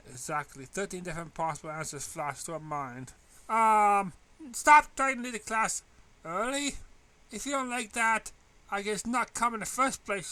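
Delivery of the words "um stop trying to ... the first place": joking. A narrator's reading. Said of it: "she joked".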